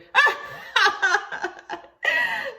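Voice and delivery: high, loud cackling laugh; mocking laugh. Virginia laughing at Libby brushing old Boss, high mocking laugh. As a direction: burst out laughing at the horse. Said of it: "Virginia burst into laughter".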